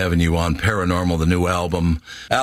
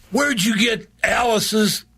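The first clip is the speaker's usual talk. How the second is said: excited friend; 12 semitones higher than usual. Retold or imitated. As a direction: play the friend gushing with excitement, pitched way up. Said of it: "He goes".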